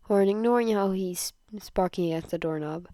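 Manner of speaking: ordinary delivery, nothing marked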